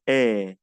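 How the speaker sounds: E drawn out with the mouth opened wider; a bigger, longer "eh" for E; wide open long E. Shown as a demonstration. Teacher models French EI to learners, long open E. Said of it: "más grande de lo normal".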